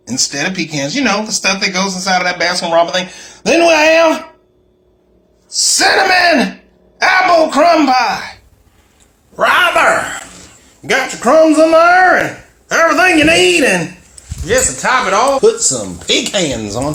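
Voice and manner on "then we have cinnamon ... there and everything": high, loud, slow showcase; excited. Real, not acted. Showing off his pies to viewers, excited. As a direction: announce the pie like a prize.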